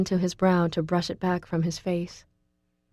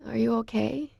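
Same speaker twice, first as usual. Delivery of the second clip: higher, softer, frail question; weak sick voice; 5 semitones higher, 5 dB quieter than usual. A narrator's reading. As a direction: ask it from a sickbed, weakly. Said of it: "so I ask".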